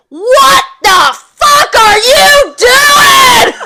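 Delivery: high, loud, slow shouted scold; mock angry yell. Imitating her mom yelling at her in the glass. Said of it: "She came in and was like".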